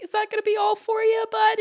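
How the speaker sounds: high, fond check-in; sweet server voice. Retold or imitated. Server wrapping up old regular's order, high sweet voice. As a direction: ask it dotingly, pitched right up.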